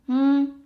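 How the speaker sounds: Hmm hummed high and level; high flat-tone Hmm; first tone. Shown as a demonstration. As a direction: hold Hmm on one high, steady note. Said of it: "都 is first tone".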